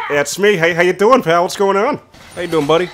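switching impression voices; Ted voice.

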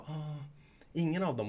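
mock offhand customer reply; casual customer answer. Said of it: "Då kommer de bara".

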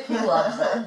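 laughing while speaking. Real, not acted. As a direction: say it through a laugh. Laughing at a funny TTS message.

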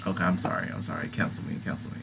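low, soft, half-whispered sorry; sheepish quiet apology. Real, not acted. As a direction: mumble an embarrassed apology. Sheepish apology after spider story.

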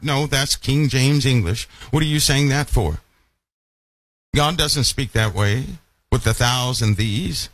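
ordinary talk

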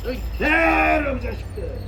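ordinary delivery

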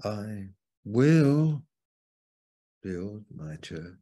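"will" loud and lifted, then "build my church" falls away; stress on WILL. Shown as a demonstration. Preacher offering another emphasis of the verse, stress on "will". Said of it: "or I could emphasize".

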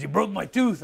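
hammed-up sore wrestler; imitating angry Hulk. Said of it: "And he was pissed".

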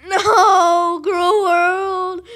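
long, loud, high wail of "no"; hammed-up cry of defeat; mock-anguished scream. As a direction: scream it like a melodramatic death, laughing. Mock death cry as he loses.

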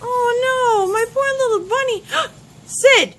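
high, loud, tearful cry; distraught wail. Acted. Player finds her poor little bunny, wailing. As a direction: cry out over the hurt pet.

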